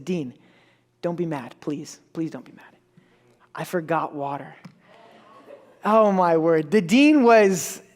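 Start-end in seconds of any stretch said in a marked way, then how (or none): 0.0-4.5 very soft, low voice with pauses; sheepish confession. Retold or imitated. Student confessing to the dean, sheepish and hushed. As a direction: confess it timidly, almost under your breath.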